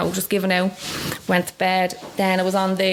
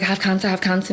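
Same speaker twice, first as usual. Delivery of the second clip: normal talking voice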